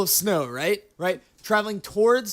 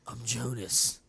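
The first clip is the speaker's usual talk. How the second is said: low deadpan; 9 semitones lower, 10 dB quieter than usual. Acted. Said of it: "they go".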